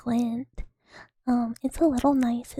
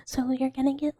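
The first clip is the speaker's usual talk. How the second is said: ordinary talk, nothing marked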